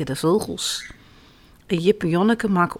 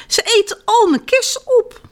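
high-pitched, exasperated complaint; exasperated farmer; 13 semitones higher, 3 dB louder than usual. A narrator's reading. Farmer lamenting his eaten cherries, exasperated. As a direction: let the complaint leap up high.

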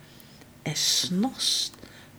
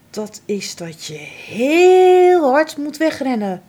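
put-on higher, louder cocky kid voice; bold young doe; 6 semitones higher, 6 dB louder than usual. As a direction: show off boldly as a fawn. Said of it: "antwoordt Feline".

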